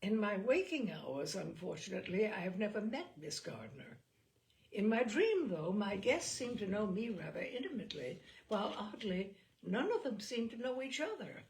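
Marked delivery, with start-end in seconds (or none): none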